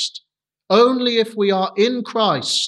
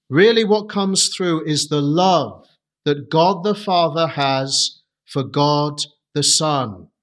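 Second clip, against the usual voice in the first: ordinary delivery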